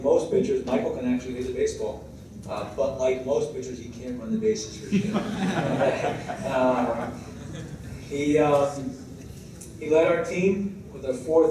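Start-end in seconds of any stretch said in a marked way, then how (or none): 5.2-6.5 laugh. Real, not acted. Laugh after joke about running.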